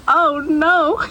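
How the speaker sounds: usual speaking voice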